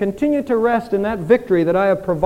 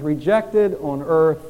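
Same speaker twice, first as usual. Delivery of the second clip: slow solemn reading; 4 semitones lower, slower than usual. Shown as a demonstration. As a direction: read the father's wire slowly and gravely. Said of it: "oh, that we could print this in our minds".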